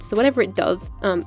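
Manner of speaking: normal talking voice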